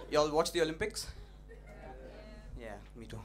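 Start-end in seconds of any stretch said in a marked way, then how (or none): none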